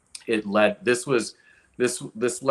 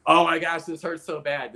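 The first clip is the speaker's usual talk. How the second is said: higher and louder, a touch of remembered pain; mock pained exclamation; 7 semitones higher, 5 dB louder than usual. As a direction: exclaim it like the ache just hit you.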